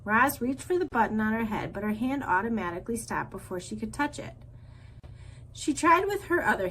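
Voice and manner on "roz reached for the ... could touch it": ordinary delivery, nothing marked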